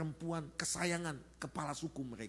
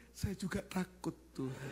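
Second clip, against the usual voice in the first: slow, mock-timid confession; frightened inner prayer; 5 semitones higher than usual. Pastor confessing fear to God inwardly, slow timid plea. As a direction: admit it slowly in a small voice. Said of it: "Dalam hati saya".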